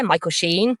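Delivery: ordinary talk, nothing marked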